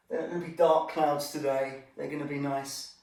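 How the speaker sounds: ordinary delivery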